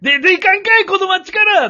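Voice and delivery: very high, loud hammed-up banishing; mock hostile Kansai local. Imagined local driving the newcomer out of town, mock angry Kansai yell. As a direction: bellow it like a cartoonishly hostile townsman. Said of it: "って言われたらもう".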